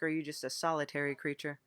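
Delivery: usual speaking voice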